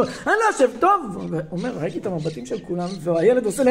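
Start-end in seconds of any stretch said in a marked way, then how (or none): 0.0-1.2 high-pitched mock refusal; defiant child. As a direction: dig in like a stubborn kid. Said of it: "והוא אומר". (ילד עקשן שמסרב בקול גבוה)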